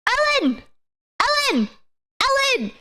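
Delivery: high-pitched squirrel calling a name; high meme squirrel, short repeated calls; squirrel meme impression. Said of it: "one squirrel meme where it's like".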